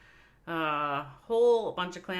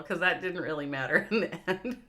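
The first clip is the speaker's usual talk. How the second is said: ordinary delivery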